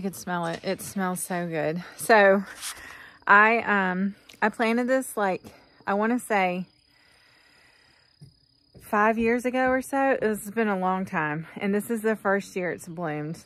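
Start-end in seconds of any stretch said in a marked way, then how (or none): none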